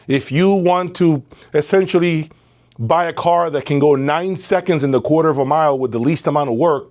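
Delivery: ordinary delivery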